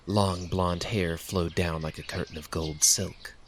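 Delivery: ordinary delivery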